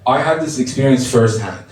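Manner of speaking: ordinary talk, nothing marked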